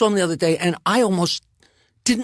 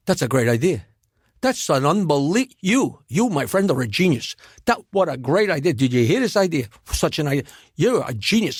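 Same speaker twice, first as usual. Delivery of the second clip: Jackie Mason impression; 4 semitones lower than usual. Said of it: "And he said".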